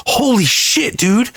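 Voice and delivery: high-pitched awed outburst; excited. Retold or imitated. Showing off the animal to Tom, imitated excitement. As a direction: imitate someone thrilled at the sight. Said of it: "you can see how excited he is".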